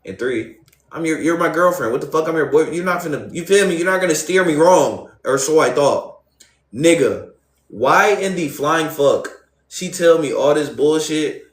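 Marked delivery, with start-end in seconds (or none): 3.8-5.1 louder, higher insistence; insisting she would never lie; emphatic trust. Real, not acted. Trusting his girl. 6.7-11.4 slow, louder flying fuck; fed up with her lies; exasperated outburst. Real, not acted. Venting about her lies.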